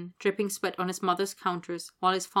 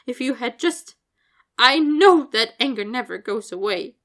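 higher, louder voice full of grief and pleading; grieving farewell speech; 5 semitones higher, 8 dB louder than usual. Acted. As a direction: speak to a lost colleague, pleading and raw.

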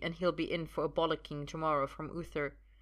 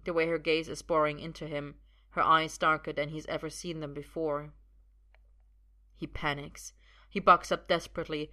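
usual speaking voice

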